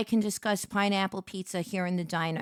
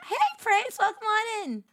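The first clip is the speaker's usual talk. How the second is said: high bright greeting; 10 semitones higher than usual. Real, not acted. Greeting a chat viewer.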